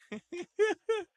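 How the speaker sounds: high squeaky giggle; a burst of high laughter. Real, not acted. Laughs at crude donor name.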